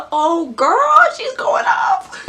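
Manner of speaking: high, loud gushing over Rey; hyped up. Real, not acted. Fan gushing to viewers, high and loud. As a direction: squeal it out, all worked up. Hyped reaction to Rey's speech.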